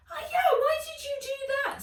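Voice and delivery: very high, loud yelp of pain. Acted. Nat yelping at the elf after a rap, high and loud. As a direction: yelp as your knuckles are rapped. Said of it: "said Nat, snatching away her hand".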